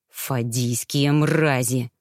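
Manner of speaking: low, slow, hissed curse; hissed through teeth. Acted. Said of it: "процедил Кейлус". (Кейлус цедит проклятие врагам, низко и медленно)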